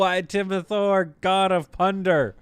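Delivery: hammed-up pompous deity declaring his title; mock god. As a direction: announce yourself like a thunder god.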